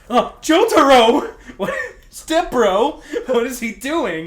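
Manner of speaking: high, loud startled yelp; startled shriek. Acted. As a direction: jolt from surprise and shriek. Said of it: "he thought".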